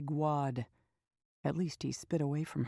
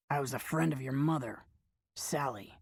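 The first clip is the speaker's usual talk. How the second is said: put-on raspy old man; 3 semitones lower than usual.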